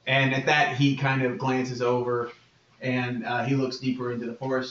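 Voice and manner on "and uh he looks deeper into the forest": ordinary talk, nothing marked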